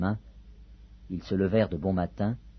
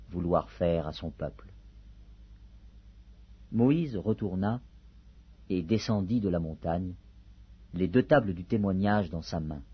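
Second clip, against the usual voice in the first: usual speaking voice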